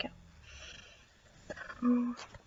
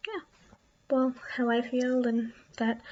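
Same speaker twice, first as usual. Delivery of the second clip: usual speaking voice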